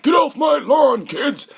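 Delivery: high, loud drawn-out holler; hammed-up old man yelling at trespassers; cranky old guy. Old guy chasing kids off his lawn, cranky shout. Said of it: "he kinda sounds like the, the old guy".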